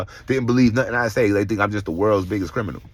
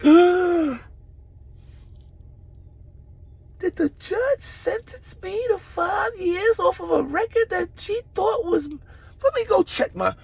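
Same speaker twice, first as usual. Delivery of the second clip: high, slow disbelieving voice; acting out waking up stunned; high, drawn-out mock shock; shocked disbelief; slower than usual.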